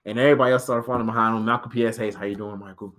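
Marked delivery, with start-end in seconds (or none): 2.1-2.9 quiet greeting. Retold or imitated.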